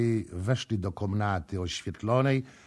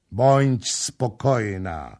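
slow, weighty and firm; grave reassurance; 4 semitones higher, 9 dB louder, much slower than usual. A narrator's reading. Prince calmly assuring Kama, slow and solemn. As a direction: promise it with solemn gravity. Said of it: "odpowiedział z powagą książę".

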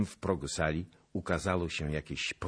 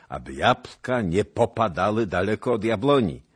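loud, higher, jovial lordly voice; booming toast of a glad duke; merry prince's toast; 5 semitones higher, 6 dB louder than usual. A narrator's reading. Prince toasting the future marriage, loud and jovial. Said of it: "rzekł książę".

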